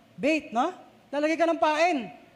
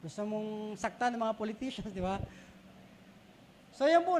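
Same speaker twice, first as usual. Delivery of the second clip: ordinary talk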